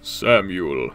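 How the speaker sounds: low drawn-out "Samuel"; drawn-out name. Pretend. Playfully intoning a viewer's name, low and drawn-out. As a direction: stretch out the name, pitched well down.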